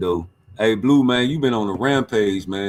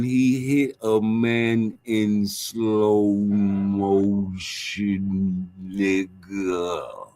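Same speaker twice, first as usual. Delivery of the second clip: slow motion; 5 semitones lower, much slower than usual. Pretend. Mimics slow motion punch.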